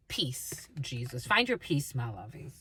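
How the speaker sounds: low, voiceless whisper; whispered aside. Real, not acted. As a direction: drop to a whisper for an endearment.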